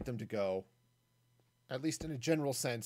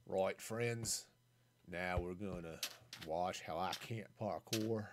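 hammed-up Australian accent, lower and softer; a deliberately bad Australian accent, slow on the parkour line; 6 semitones lower, 6 dB quieter, slower than usual. To stream viewers before parkour, a mock, badly faked Australian accent. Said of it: "started with a really bad Australian accent".

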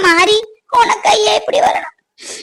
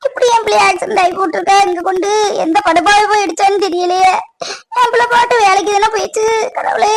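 tearful wailing. Acted. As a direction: wail to God through tears. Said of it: "அம்மா, அழாதீங்க". (அழுது புலம்பும் குரல்)

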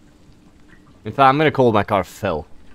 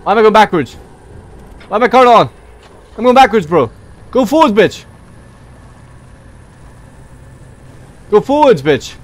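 high, loud panicked yells; panicked yelling; 7 semitones higher, 6 dB louder than usual. Real, not acted. Gamer yells at his car rolling backwards in panic. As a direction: yell at the car in a real panic.

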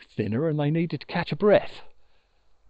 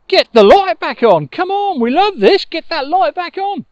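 loud, high clamouring demand; excited shouting; 11 semitones higher, 11 dB louder than usual. Acted. Swans clamouring at Moon to shine again, loud high shouting. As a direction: shout it like an eager crowd that wants more.

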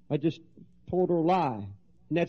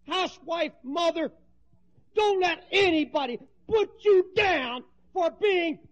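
preaching at full force; 9 semitones higher than usual. Acted. Preacher exhorting the women in the congregation, full force.

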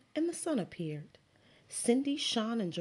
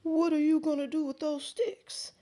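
higher, louder grandfatherly question; grandpa's voice; 9 semitones higher, 4 dB louder than usual. Acted. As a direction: voice the curious grandpa. Grandpa asks Max a question.

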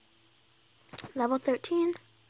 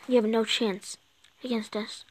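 normal talking voice

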